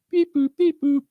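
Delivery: very high electronic beep boop; 24 clock beeps. Retold or imitated. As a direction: chirp the ticking countdown clock. Imitates the 24 clock.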